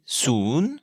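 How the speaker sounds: "soon" with a long OU, rising like a question. Shown as a demonstration. Teacher showing Thai zero, long rising "soon". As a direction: stretch "soon" and lift its end like a question. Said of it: "un ou long et montant comme une question".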